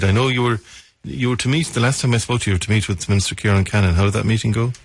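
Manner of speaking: ordinary talk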